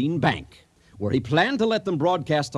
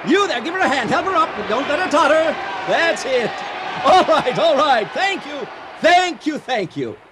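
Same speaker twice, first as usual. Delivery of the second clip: much higher, louder emcee shouting over a crowd; announcer calling out; 11 semitones higher, 4 dB louder than usual. Acted. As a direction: hype up the audience like a showman. Emcee presents the bride to audience.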